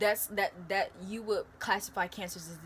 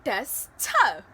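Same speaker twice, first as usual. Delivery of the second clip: impressed; 3 semitones higher, 9 dB louder than usual. Retold or imitated. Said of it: "you're gonna be like".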